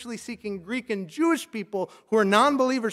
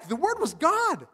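slow, high, every word hammered; emphatic; 10 semitones higher, slower than usual. Shown as a demonstration. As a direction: proclaim "The Word was God" with slow, high force. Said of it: "Like, it's emphatic".

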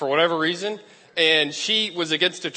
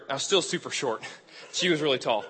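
normal talking voice

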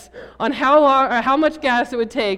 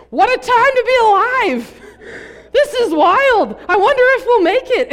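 put-on much higher and louder, an overjoyed ten-year-old; thrilled kid; 12 semitones higher, 10 dB louder than usual. Ten-year-old in a sputtering van, cheering with joy. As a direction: yell it like the best ride of your life. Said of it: "My sister, on the other hand, freaking out".

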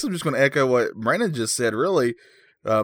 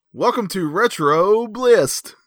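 signature show intro; 6 semitones higher, much slower than usual. Shown as a demonstration. Host says the line again for Brandon to copy, signature intro. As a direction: stretch each word like a show opener. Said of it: "want me to say it again".

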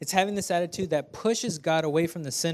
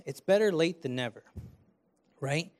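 ordinary delivery

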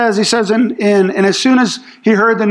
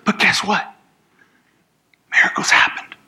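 hushed whisper; 3 dB quieter than usual. Retold or imitated. Disciples hushed reveal to Jesus.

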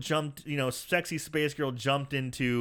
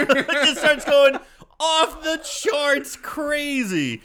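higher, very slow stretched-out words; excited drawl; 10 semitones higher, 6 dB louder, slower than usual. Real, not acted. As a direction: savor every word with delight. Excited retelling of chaos.

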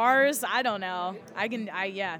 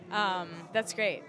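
usual speaking voice

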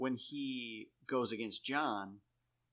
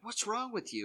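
mock taunt; 11 semitones higher than usual. Trainees taunting Sam while beating him.

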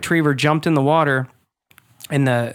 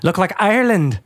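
higher, hammed-up Irish lilt; bad Irish accent; 4 semitones higher than usual. Joking that rainy L A turned green, bad Irish accent. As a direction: attempt a terrible Irish accent. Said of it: "That was the worst accent ever".

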